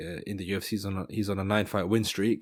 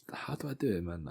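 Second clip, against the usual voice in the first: softer, half-whispered aside; muttering to self; 8 dB quieter than usual. Real, not acted. Speaker puzzles over an accent, muttering softly. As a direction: mutter it under your breath.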